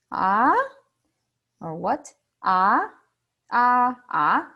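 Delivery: "Ah" and "what" rising like a question; second tone, surprised. Shown as a demonstration. Patient told a tooth comes out, rising surprised question. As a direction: lift "Ah" in startled disbelief. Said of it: "doctor said you need to pull out".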